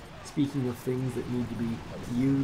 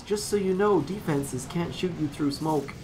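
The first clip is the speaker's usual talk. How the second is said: higher, imitated tutorial voice; mock a game tutorial guy's announcement; put-on tutorial helper, pitched up; 5 semitones higher than usual. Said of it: "the tutorial guy comes on and says".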